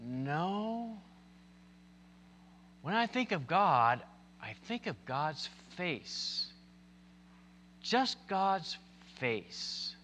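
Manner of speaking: a hint of a thoughtful child; little girl. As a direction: quote a child softly, with slow pauses. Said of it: "the girl said".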